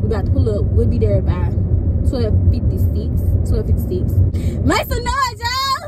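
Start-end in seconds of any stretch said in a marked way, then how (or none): 4.7-5.9 very high, celebratory call; rally everyone in a high holler; hyping the crowd. Real, not acted. Calling viewers to celebrate, high excited hype. Hypes up viewers on graduation day.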